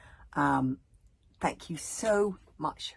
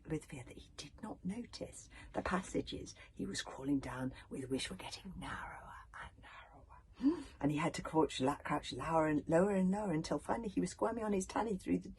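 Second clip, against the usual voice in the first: usual speaking voice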